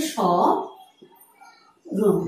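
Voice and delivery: স্মরণ slow and soft, said as শঁরোন; nasal শঁ then রোন, drawn out; স্মরণ ধীরে নাসিক্য উচ্চারণ; slowly spelled out. Shown as a demonstration. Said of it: "উচ্চারণটি তাহলে কেমন হচ্ছে".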